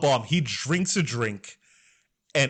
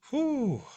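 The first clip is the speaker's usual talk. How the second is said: high, falling "whew"; emotional exhale; 13 semitones higher than usual. Real, not acted. Storyteller collecting themselves after an emotional retelling, emotional exhale. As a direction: let out a big relieved whew.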